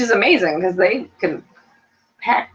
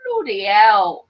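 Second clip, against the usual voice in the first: mock British; 3 semitones higher, 3 dB louder, much slower than usual. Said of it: "So he says".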